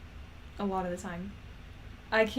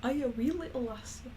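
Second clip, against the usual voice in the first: put-on Scottish accent; 3 semitones higher than usual. Practicing her Scottish lassie character, mock Scottish accent. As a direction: ask it in a playful wobbling Scottish brogue. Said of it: "sounds Irish when I say it".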